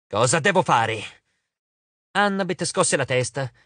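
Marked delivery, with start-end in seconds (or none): 0.1-1.2 slow, grudging question. A narrator's reading. Arachne giving in to Annabeth, slow and grudging. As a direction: ask it slowly, giving in reluctantly. Spider yielding to Annabeth's plan.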